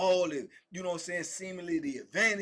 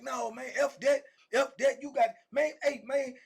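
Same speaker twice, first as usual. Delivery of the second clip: raised, higher-pitched mock objection; heated refusal; 6 semitones higher, 4 dB louder than usual. Cellmate refuses to wait at the microwave, heated refusal. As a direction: act out cutting him off angrily. Acted refusal in retold quarrel.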